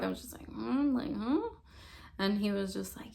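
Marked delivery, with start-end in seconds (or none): 0.5-1.5 soft, high questioning hums; imitated speechlessness. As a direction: act out being too dazed for words. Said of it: "I couldn't even really talk".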